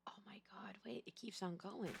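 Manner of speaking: hushed, breathless whisper. Real, not acted. Streamer hushing to herself as the show goes on, whisper. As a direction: whisper it so nobody hears.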